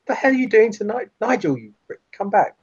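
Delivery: high-pitched, agitated calling after someone; mock panic, pitched well up; freaking out. Companions call Nigel back, freaking out. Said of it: "are kind of freaking out".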